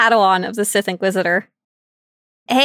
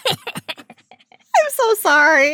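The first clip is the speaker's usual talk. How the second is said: hammed-up apology; 6 semitones higher, 3 dB louder, much slower than usual. Drawn-out apology amid laughter.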